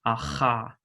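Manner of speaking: "aha" with a rasping H far back in the throat; uvular fricative demo. Shown as a demonstration. Teacher to students, showing the uvular fricative. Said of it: "a voiceless uvular fricative".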